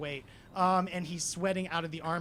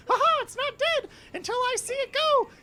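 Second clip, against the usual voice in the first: very high, loud cartoon squeal; Walt Disney; 10 dB louder than usual. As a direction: manic falsetto cackle, out for blood. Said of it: "you watch as Walt Disney".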